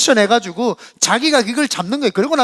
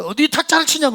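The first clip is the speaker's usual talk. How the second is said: shaman scolding; 7 semitones higher than usual. Retold or imitated. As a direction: snap back at him. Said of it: "막 싸우고 막 그러더라고요".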